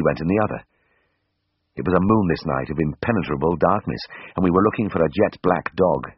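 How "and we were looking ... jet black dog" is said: plain reading voice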